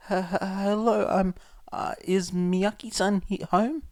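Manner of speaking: jittery, halting greeting; nervous stammer. A narrator's reading. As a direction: stumble over every word in fear. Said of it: "Izuku nervously asked".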